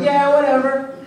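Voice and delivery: careless, offhand brush-off; bored dismissive. Retold or imitated. Ed shrugging off Al's cheer, offhand. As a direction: toss it off like a bored big brother. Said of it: "getting ready to record".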